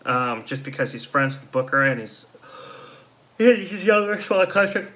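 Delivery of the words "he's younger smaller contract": talking through a yawn; words stretched by a yawn. Real, not acted. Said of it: "I don't know why I'm yawning".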